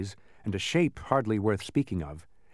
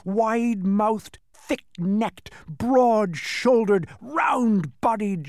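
high, slow, loud creature voice; menacing monster boast; 10 semitones higher, 5 dB louder, much slower than usual. Acted. Demon describing himself to prisoners, slow high menace. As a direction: draw out each feature to frighten them.

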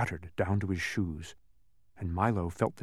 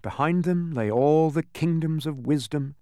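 usual speaking voice

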